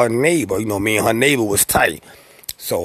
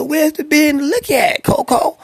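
much higher mock wife voice; imitating the wife. Wife asking Coco where the beer and liquor are, high mimic. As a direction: put on the wife's voice to demand the liquor.

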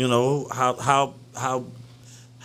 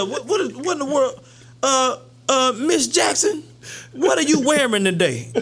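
shocked principal; 7 semitones higher than usual. Acted. Mocking a teacher's outfit.

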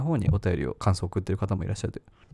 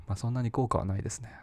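ordinary talk, nothing marked

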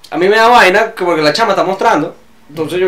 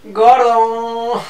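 shouted gordo; 7 semitones higher than usual. Real, not acted. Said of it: "quería gritar "gordo".

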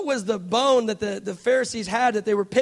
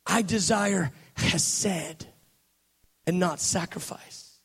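slow reverent reading; 6 semitones lower, 5 dB quieter, slower than usual. Acted. Reads the verse slowly.

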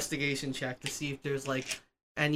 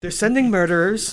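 loud villain rant; 5 semitones higher, 6 dB louder than usual. Acted. Acting Shredder's rant.

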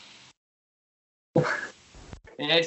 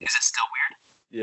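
sounding like a vintage radio broadcast; 2 semitones lower, slower than usual. Real, not acted. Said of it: "What's it sound like?".

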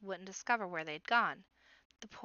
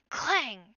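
loud, ringing "clang"; imitated metal clang; 3 semitones higher, 9 dB louder than usual. A narrator's reading. Narrator sounding a dagger on the portcullis, loud clang. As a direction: voice the blade striking iron.

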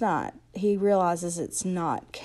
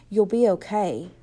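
firmly; 6 dB louder than usual. A narrator's reading. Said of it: "I repeated firmly".

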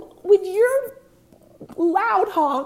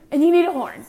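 louder, punchy emphatic line; excited; 7 dB louder, faster than usual. Real, not acted. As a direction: sell it like a stadium fan. Excited point about game horns.

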